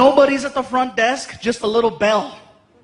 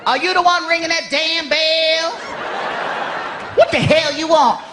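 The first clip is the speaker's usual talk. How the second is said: sassy clerk; 4 semitones higher than usual. Acted. Sassy motel clerk voiced.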